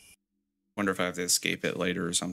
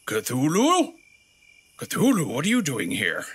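high, louder surprised call; mock surprise; 4 semitones higher, 4 dB louder than usual. Player spotting Cthulhu in the house, mock surprise. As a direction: greet the old god in feigned shock.

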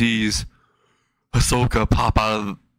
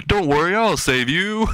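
mock heroic call; 8 semitones higher than usual. Calls to kidnapped child while chasing.

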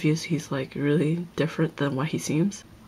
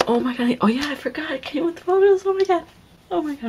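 much higher and louder, rising real delight; excited squeal; 11 semitones higher, 4 dB louder, faster than usual. Real, not acted. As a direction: squeal as you discover the bonus photos. Finds surprise photos in album.

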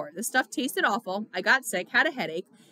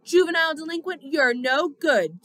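loud, raised mock accusation; shouted; 5 semitones higher, 5 dB louder than usual. A narrator's reading. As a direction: shout it out, dragging the last words. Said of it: "Derry shouted".

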